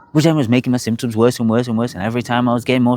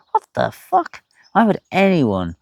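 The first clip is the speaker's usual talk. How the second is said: high, stunned what-the-fuck; shocked disbelief; 9 semitones higher, slower than usual. Retold or imitated. Stunned when told to stay on the medication for life. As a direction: recoil in disbelief. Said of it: "And I thought".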